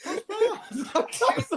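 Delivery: giggle-soaked; laughing. Real, not acted. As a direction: crack up mid-word. Cracking up over hash browns.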